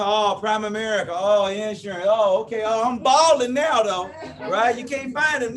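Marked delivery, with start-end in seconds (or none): 0.0-4.1 louder, sneering mock voice of the doubters. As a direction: mimic the scoffers who laughed at you, then sneer about them balling. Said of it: "People go".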